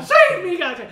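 high, loud cry for rescue; desperate shout. Retold or imitated. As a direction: yell it like you're begging for your life. People throwing themselves, begging.

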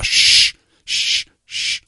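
drawn-out serpent hiss; the preacher imitates a serpent hissing; snake hiss. Shown as a demonstration. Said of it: "to simulate the sound of a snake".

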